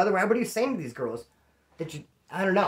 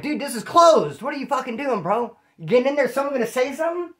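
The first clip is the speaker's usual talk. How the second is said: mock outraged yelling; 6 semitones higher than usual. Confronting guy in closed shower area.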